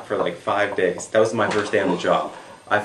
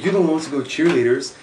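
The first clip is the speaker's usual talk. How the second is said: fast, high, loud comeback; insistent retort; 8 semitones higher, 7 dB louder, faster than usual. Retold or imitated. Buddy pushes nervous friend to go first, insistent. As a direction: throw it back at him quickly.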